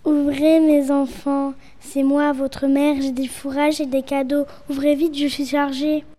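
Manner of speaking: ordinary talk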